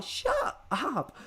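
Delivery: high squeaky mock shriek. Mocking the annoying judge, high squeal. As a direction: squeal it in disbelief.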